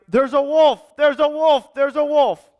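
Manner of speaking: high, urgent cry of alarm; yelling the alarm. A narrator's reading. Storyteller as shepherd boy raising a false alarm, yelling. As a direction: call it out high, three times over. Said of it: "he started yelling".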